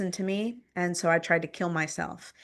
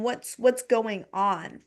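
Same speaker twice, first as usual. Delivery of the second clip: plain reading voice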